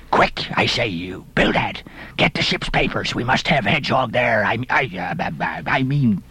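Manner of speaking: low, rough urgent ordering; lightly voiced flustered old captain. A narrator's reading. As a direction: hurry your partner along, stumbling over the name. Peleg aghast, hurrying his partner.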